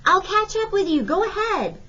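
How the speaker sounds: high calling voice. A narrator's reading. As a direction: call it back over the crowd. Bud calls back to Bugs.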